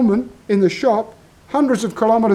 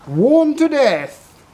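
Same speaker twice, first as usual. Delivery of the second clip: dramatic drawn-out lament; 8 semitones higher, 4 dB louder, much slower than usual. Acted. Punchline about worn-out men's clothes.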